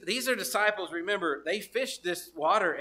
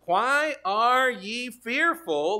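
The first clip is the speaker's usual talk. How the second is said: Jesus rebuking gently, drawn out; slow solemn rebuke; 4 semitones higher, 5 dB louder, much slower than usual. Acted. Preacher voicing Jesus rebuking the fearful disciples. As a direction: stretch it out like a patient teacher.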